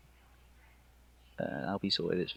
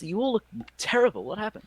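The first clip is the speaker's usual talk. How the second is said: alarmed ranger; 12 semitones higher than usual. Acted. GM voices ranger seeing bloodied party.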